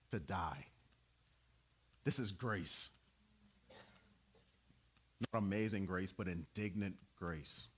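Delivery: preacher whispering the closing line of grace; hushed preaching. Real, not acted. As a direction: bring it down to a near whisper. Sermon falls to hushed close.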